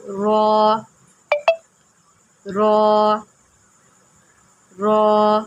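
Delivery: رو said full and heavy. Shown as a demonstration. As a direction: make رو thick and rounded. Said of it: "رو کو پر پڑھیں گے".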